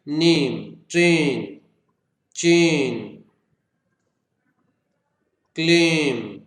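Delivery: नेम, ट्रेन, चेन, क्लेम loud and drawn out, one sound each; one-syllable demo. Shown as a demonstration. Teacher showing one syllable, नेम, ट्रेन, चेन, क्लेम stretched singly. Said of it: "सिर्फ एक ही syllables".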